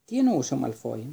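plain reading voice

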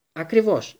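bloodthirsty. A narrator's reading. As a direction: relish the word like a killer. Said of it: "με αιμοβόρο ύφος".

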